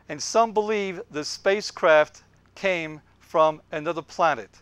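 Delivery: slowly read, stretched out; slowed-down reading of the newspaper line; slow motion. Shown as a demonstration. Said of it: "I'll do it in slow motion".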